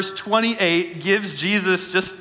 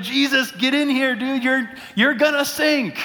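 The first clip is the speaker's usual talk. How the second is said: higher, urgent half-joking alarm; mock panic; 7 semitones higher than usual. Pastor imagining himself in the boat, urging Jesus in, mock panic. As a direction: plead with a buddy who's about to go under. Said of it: "I'd be like".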